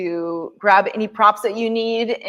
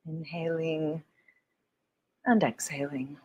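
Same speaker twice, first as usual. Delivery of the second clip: guided meditation; 4 semitones lower than usual. Acted. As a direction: ease them into stillness, gently.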